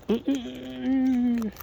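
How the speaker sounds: drawn-out high ooh sounds, slow; slow high cooing. Real, not acted. Cooing at the birds at the feeder, slow and high. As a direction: coo at them slowly, up high.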